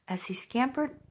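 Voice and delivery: ordinary delivery, nothing marked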